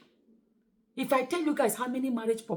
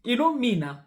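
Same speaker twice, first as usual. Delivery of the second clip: ordinary talk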